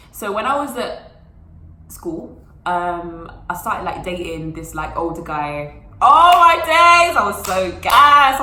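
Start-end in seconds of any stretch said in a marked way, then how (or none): 6.0-8.4 loud, slow, thrilled squeal; gushing with glee at the news; excited gush. Real, not acted. Telling the camera how thrilled she was, loud and thrilled.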